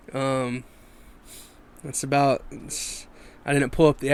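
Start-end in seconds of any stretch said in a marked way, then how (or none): none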